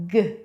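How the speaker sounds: G as a short "guh", not the letter name; brief isolated hard "guh" for G; hard G sound. Shown as a demonstration. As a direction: say G as the first sound of goat. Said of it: "Letter G makes the".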